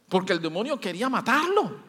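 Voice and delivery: normal talking voice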